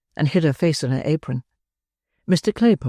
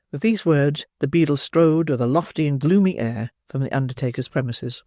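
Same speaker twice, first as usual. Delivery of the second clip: usual speaking voice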